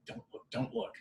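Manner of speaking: imitating an urgent unvoiced whisper; hushed warning. Date warns him not to turn around, hushed whisper. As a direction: whisper it as his date's covert warning.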